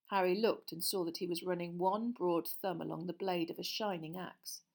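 usual speaking voice

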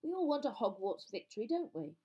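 coaxing with mild impatience; impatient. A narrator's reading. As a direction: press them impatiently to agree. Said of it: "said Bagman impatiently".

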